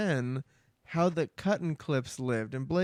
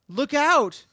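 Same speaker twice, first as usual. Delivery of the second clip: high, loud warning call; squirrel calling out; 9 semitones higher, 7 dB louder than usual. A narrator's reading. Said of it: "he calls out".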